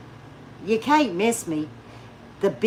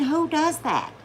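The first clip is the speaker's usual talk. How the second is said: ordinary talk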